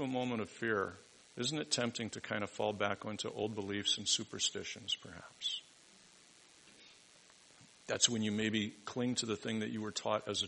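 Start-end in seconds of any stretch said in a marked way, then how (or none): 5.0-5.6 breathy whisper, dropping the voice right down; whispered aside. Acted. Preacher whispers a doubting aside to the congregation. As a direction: let it slip out as a secret afterthought.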